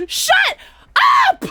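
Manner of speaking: high, loud "Shut up!"; high loud exclaim; shouting. Real, not acted. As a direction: yell it out in disbelief. Laughing at chat.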